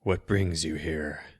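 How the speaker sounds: slow, rasping and cold; rasping old wizard. Acted. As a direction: rasp the question slowly. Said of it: "the wizard rasped".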